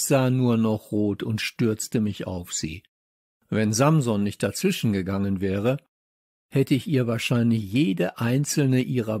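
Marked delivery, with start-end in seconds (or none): none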